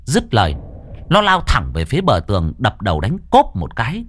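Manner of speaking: tense, lively storytelling voice; horror story narration. A narrator's reading. Narrator telling a ghost story to listeners, tense storytelling. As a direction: pull listeners into the haunted boarding house.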